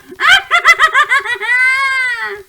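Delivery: very high, loud, shrieking cackle; shrieking witch cackle. Acted. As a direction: let loose a wild witch cackle. Witch laughs after greeting.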